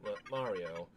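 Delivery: computer voice. Acted. Fortress computer calmly telling Mario about Peach. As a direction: speak like a measured fortress computer.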